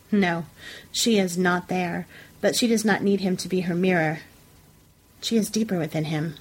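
ordinary delivery, nothing marked

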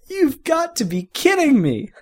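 high, loud laughing disbelief. Acted. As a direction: crack up while taunting. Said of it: "laughing until tears dripped".

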